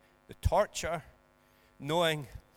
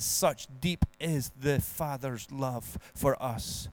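slow, measured hymn lyrics; hymn recited; 3 semitones lower, 3 dB louder, slower than usual. Acted. Pastor reciting a hymn to the congregation, slow and measured. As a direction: linger on each hymn line.

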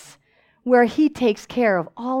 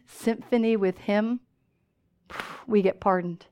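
normal talking voice

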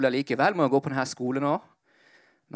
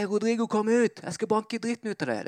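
mock yell; 8 semitones higher than usual. Kid outside challenging Rodrigo to a fight, mock yell. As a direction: imitate a kid calling someone out, pitched up high. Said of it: "Så roper han fra kiden".

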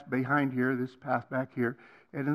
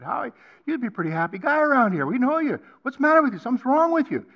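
voiced worried friends; 9 semitones higher, 7 dB louder, faster than usual. Friends confront him, voiced quote.

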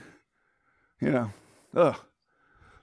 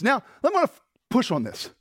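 ordinary talk

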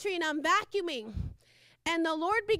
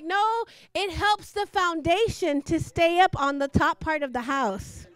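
the owner's voice, a touch louder and set apart; owner's reply; 6 dB louder than usual. Retold or imitated. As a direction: answer firmly as the owner. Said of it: "And the owner's like".

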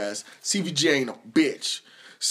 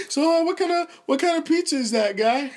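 high, mock-casual fishing question; prying moocher; 11 semitones higher than usual. Someone eyeing your meal, mock-casual prying voice. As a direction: imitate someone sidling up to ask. Acting out people fishing for food.

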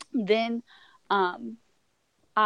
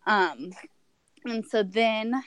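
ordinary talk

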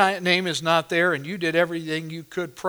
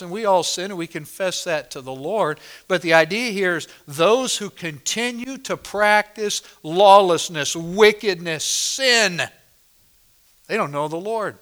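usual speaking voice

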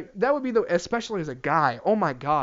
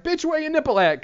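much higher, squeaky comic challenge; mock indignant demand; 10 semitones higher than usual. Comedian acting out demanding to know from partner, mock indignant. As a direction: demand it in a high mock outrage. Acted quote in bit.

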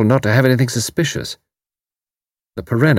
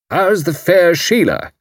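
put-on bluff old commander; 9 semitones higher, slower than usual. A narrator's reading. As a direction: boom it out like a blustering retired officer. Haydock voiced.

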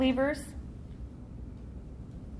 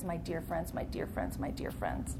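softer each time, trailing off into a whisper; fading echo; 5 semitones lower, 12 dB quieter than usual. Retold or imitated. Teacher echoing Peter's letters to the class, fading to a whisper. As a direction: let the greeting fade away tenderly.

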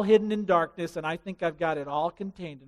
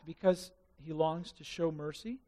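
normal talking voice

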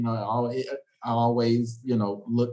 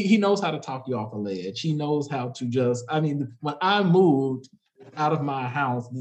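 ordinary delivery

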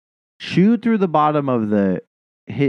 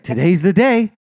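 higher, quicker gleeful cat voice; eager cat; 7 semitones higher, 4 dB louder than usual. Retold or imitated. The cat watching the bird, eager and higher. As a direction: announce it like a hungry cat.

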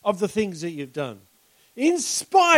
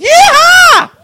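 high, loud joyful yeehaw whoop; loud cowboy whoop of joy; 15 dB louder than usual. Retold or imitated. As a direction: throw out a big cowboy cheer. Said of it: "stick it out with".

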